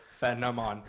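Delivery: phenomenon sounded out as FAN-uh-mon; misspelled pronunciation. Shown as a demonstration. Judge reading back the wrong spelling, phenomenon as FAN-uh-mon. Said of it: "the word you spelled was".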